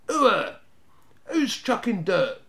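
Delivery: high, loud, slow suspicious yell; boy yelling up suspiciously at an unseen thrower; suspicious cry. A narrator's reading. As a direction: shout it out, wary of the hidden thrower. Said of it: "cried the first Snarget suspiciously".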